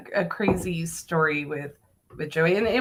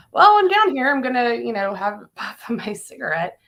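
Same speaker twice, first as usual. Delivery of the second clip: mock nonchalance; 5 semitones higher, 5 dB louder than usual. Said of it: "I'm like".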